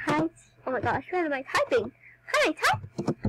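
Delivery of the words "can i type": very high squeaky question; squeak of disbelief. Real, not acted. Girl struggling to type in chat, high squeak. Frustrated at typing.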